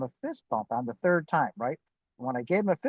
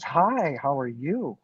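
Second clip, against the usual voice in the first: smiling greeting; slower than usual. Retold or imitated. Smiling stranger greets him by the Jeep, smiling greeting. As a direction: imitate a beaming stranger saying hello. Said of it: "he was just smiling".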